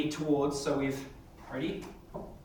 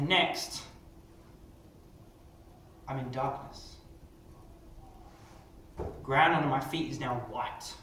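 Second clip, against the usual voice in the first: story narration; 4 dB quieter than usual. Acted. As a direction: narrate the story to the class with suspense. Teacher narrates model story.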